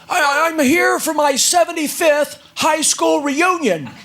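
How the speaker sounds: put-on high, slow old gentleman voice; quavering old man, slowly.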